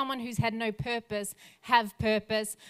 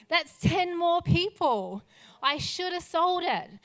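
high, strained, slow cry of regret; weeping regret; 7 semitones higher, 5 dB louder than usual. Retold or imitated. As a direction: voice him breaking down over what he could have saved. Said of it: "And he begins to weep".

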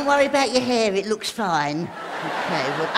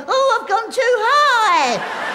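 mock housefly voice; 8 semitones higher, 5 dB louder, much slower than usual. Voicing the housefly.

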